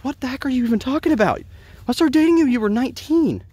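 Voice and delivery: very high, disbelieving outcry; high, stunned disbelief; incredulous retort. Retold or imitated. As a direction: react as if the claim is absurd. Exasperated retort to accusation.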